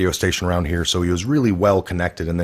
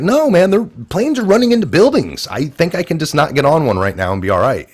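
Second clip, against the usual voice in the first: incredulous public; 9 semitones higher, 5 dB louder than usual. Retold or imitated. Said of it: "everyone's like".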